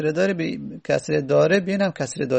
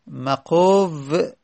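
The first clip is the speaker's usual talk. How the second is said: slow drilled word; much slower than usual. Shown as a demonstration. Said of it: "teacher drills the form slowly".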